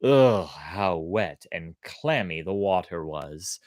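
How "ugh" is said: usual speaking voice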